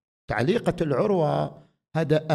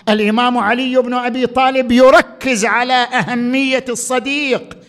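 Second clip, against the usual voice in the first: preaching; 7 semitones higher, 7 dB louder than usual. Acted. Preacher raises voice.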